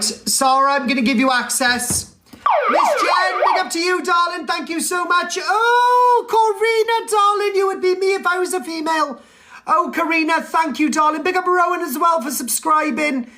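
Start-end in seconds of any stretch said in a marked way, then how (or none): 2.7-11.8 high, delighted camp thank-you; gushing thanks. Real, not acted. Streamer thanking gifters, high gushing delight. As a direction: gush over the gift givers.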